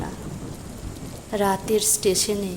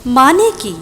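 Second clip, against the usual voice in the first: surprised; 5 semitones higher, 9 dB louder than usual. Acted. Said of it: "অবাক হয়ে তাকালাম".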